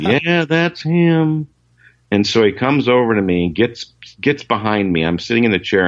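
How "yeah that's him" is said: high, slow mock drawl. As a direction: drag it out lazily, amused. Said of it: "Chet said".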